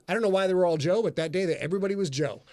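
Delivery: usual speaking voice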